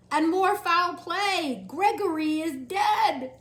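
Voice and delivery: slow, loud, high cry of alarm; shouted. Acted. Cook shouting the news of a death. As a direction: bellow out the terrible news. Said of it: "shouted the cook".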